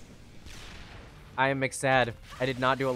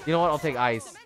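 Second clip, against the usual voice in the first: usual speaking voice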